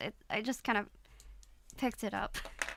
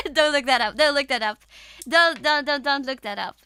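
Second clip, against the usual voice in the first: louder, urgent begging; loud plea; 10 dB louder than usual. Real, not acted. Streamer begging chat not to look up her Dutch insult, loud plea. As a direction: plead loudly with chat to stop.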